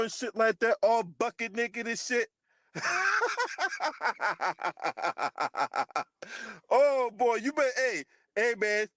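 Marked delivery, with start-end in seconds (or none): 2.7-6.7 drawn-out helpless cackle; long cackling laugh. Real, not acted. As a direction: crack up at your own joke. Laughing at his own joke.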